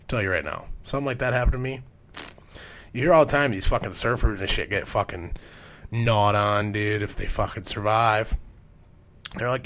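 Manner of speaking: normal talking voice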